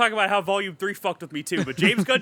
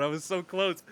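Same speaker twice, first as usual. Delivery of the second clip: normal talking voice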